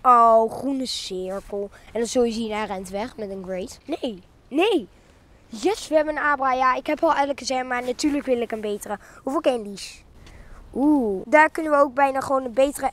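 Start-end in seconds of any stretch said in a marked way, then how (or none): none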